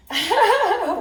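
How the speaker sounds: bright burst of laughter, pitched high. Real, not acted. Vlogger laughing at her own joke to viewers, a high burst of laughter.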